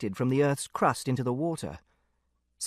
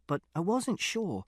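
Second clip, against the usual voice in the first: ordinary delivery, nothing marked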